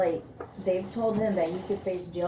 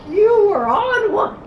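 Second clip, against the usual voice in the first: louder, slow, laughing retort; laughing boyfriend quote; 12 semitones higher, 11 dB louder than usual. Retold or imitated. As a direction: tease her, laughing and loud. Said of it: "He was like".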